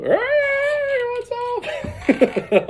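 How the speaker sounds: high falsetto hey; Jeff's greeting. Retold or imitated. Jeff calling out to the troopers in a high falsetto. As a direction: squeak it out cheerfully. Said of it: "what does Jeff do".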